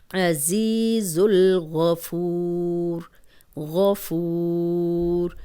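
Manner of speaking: الغفور with a heavy غ and a long و; slow recited demo. Shown as a demonstration. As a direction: recite غفور slowly, thick غ, stretched oo. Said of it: "اب غین موٹا ہے اور فو واو مدہ ہے". (غفور، موٹا غین اور لمبا واو)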